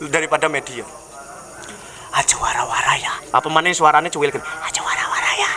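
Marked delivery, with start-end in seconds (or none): 2.2-3.2 whispered gossip warning; secretive whisper. Acted. 4.6-5.5 whispered "Aja wara-wara ya", no voice at all; secretive whisper. Acted. Gossiper hushing secret.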